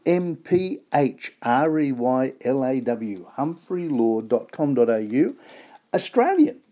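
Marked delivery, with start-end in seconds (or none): 5.9-6.6 much higher, proud exclamation; excited. Real, not acted. Presenter cheering the Aussie-made socks, excited. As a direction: sell it with a beaming cry.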